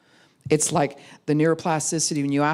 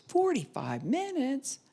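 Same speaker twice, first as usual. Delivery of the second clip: high, drawn-out dismay; surprised; 11 semitones higher, 4 dB quieter, slower than usual. Retold or imitated. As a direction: kid shocked at the wait. Kid hears the wait time.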